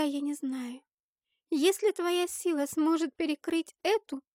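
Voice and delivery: put-on high, insistent child's voice; earnest little girl. A narrator's reading. Girl persuading Helovisa she alone can manage it, high and earnest. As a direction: urge her on in a girl's high pitch. Girl urges the heroine to take it.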